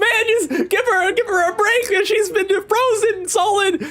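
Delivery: high, laughing character voice; lightly voiced excited skeleton; a hint of squeaky Brook. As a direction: squeal it while cracking up. Voicing characters, much higher.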